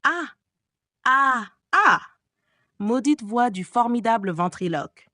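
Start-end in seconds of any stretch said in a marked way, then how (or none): 0.0-2.0 short, loud, higher cries of terror; cries of fright. Acted. The captives crying out in fear at the voice. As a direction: scream out in panic. Terrified screams in the dark.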